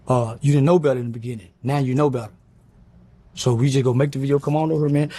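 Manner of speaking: big dude's voice. Retold or imitated. Big muscular dude pushing him to make an apology video, in a low imitating voice. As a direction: lean in low like the big guy pressing you. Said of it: "He said".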